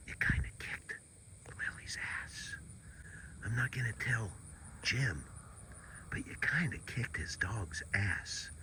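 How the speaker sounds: conspiratorial whisper. Real, not acted. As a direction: whisper a secret to the bunny. Secret whispered to bunny.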